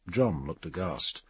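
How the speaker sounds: usual speaking voice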